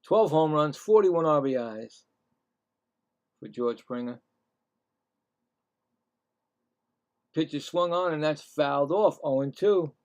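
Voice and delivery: announcer voice for the at-bat; play-by-play announcer. Acted. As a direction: call each pitch like the broadcast booth. Calling batters and pitches.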